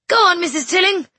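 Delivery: high, loud encouraging call. A narrator's reading. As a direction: cheer her on from the organ. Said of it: "Missus Quayle called from the organ".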